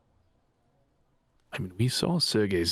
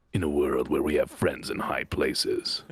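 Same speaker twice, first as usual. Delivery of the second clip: low, slow movie-trailer voice; mock trailer announcer; 6 semitones lower than usual. Player turns the party's joke into a trailer, low mock announcer voice. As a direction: intone it deep like a film trailer.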